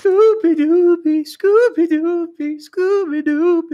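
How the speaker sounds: put-on high, slow sing-song baby voice; baby talk. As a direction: coo it like to a baby. Cooing at friend, baby Scoob.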